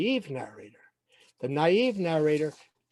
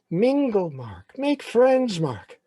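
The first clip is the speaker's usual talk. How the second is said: urging parent; 6 semitones higher, 4 dB louder, slower than usual. Acted. Parents' advice echoing in the boy's head, urging. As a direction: nag with a sharp push, then fall.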